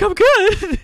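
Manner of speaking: a high, giggling echo of the friend's words; laughing disbelief. Retold or imitated. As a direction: blurt it out through a laugh of relief. Retelling his own relieved reaction.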